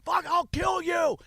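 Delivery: high, slow mock scream; imitated screaming. Enraged driver threatening the man laughing at him, screamed. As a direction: scream the threat, stretched out in rage. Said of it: "And he's screaming at me".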